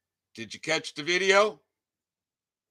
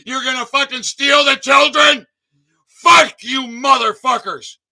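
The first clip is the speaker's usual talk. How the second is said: loud, higher, raging tirade; furious shouting; 8 semitones higher, 10 dB louder than usual. Real, not acted. Vlogger raging at police who lock families up, furious yelling. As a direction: scream the accusations without a break.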